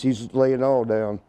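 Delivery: usual speaking voice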